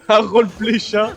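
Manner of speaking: laughing. Real, not acted.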